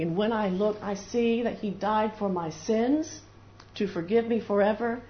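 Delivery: ordinary talk, nothing marked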